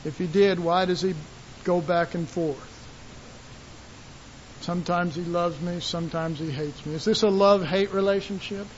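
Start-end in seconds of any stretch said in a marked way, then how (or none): 4.6-6.9 soft, low and unsure; doubting mutter. Retold or imitated. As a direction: say it like a private doubt. Voicing doubting believer.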